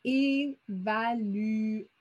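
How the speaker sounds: "Évaluer" stretched very slowly, lingering on the end; drawn-out word. Shown as a demonstration. Teacher stretching the word so pupils catch the final sound. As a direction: drag the word out to hear the last sound. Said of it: "qui est le dernier son".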